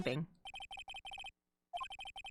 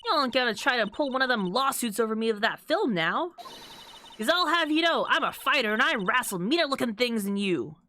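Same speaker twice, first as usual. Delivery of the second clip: put-on Texan accent. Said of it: "We got an angry Texan here".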